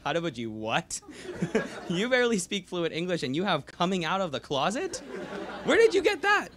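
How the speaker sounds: rising to a squeal of amazement; amazed disbelief. Retold or imitated. Retelling the reply to grandfather's coming-out remark, in laughing disbelief.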